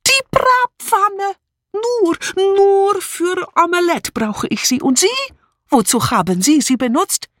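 put-on very high, indignant foreign-accented tirade; fly at her, stretching the accusing words; angry outburst. A narrator's reading. Mitzi attacking Julia over her kitchen, furious outburst. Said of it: "fuhr sie los".

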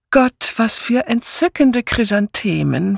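high, fluting gush; high fluting delight over the flowers. A narrator's reading. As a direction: trill it like a fussy lady. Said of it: "flötete Misses Easterbrook".

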